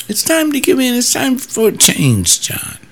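high, wheedling voice of the people pushing him; mocking the pressure. Pastor mimicking people pressing him to give in, high and coaxing.

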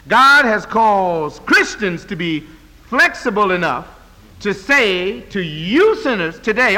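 rousing sermon climax, drawn out and loud; full-force preaching. Acted. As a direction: preach it out to the whole church, building to a peak.

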